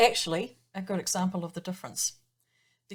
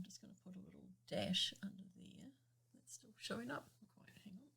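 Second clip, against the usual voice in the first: whispered aside; 17 dB quieter, faster than usual. Real, not acted. Aside while drawing.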